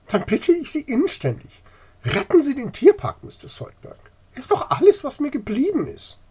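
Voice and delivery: high, loud urgent plea; old lady begs for her zoo; pleading. Acted. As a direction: beg him with everything. Lady begs him to save zoo.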